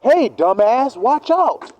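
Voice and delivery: high, sharp "watch out" call; mock warning yell; warning shout. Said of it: "they said".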